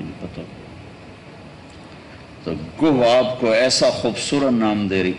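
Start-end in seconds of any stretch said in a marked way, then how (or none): 0.0-0.7 a faint unvoiced whisper of a shrugging reply; whispered mock answer. Village boy's answer about the Prophet's name, whispered. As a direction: let the repeated reply fade to a whisper. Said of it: "کہا".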